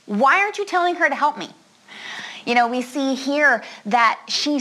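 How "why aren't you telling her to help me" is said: higher and quick, an exasperated complaint; irritated Martha. Retold or imitated. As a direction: blurt it out like an annoyed sister. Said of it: "and is like".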